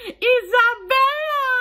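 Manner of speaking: high, loud scream of recognition; screamed greeting. Retold or imitated. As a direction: scream out a friend's name in mock earnest. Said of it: "she screamed".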